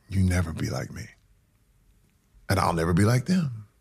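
normal talking voice

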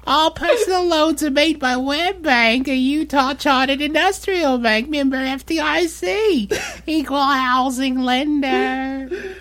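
very high, jerky character read; hammed-up falsetto for the fine print; silly put-on voice. Host reading loan disclaimer for listeners, silly high voice. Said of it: "What is this voice?".

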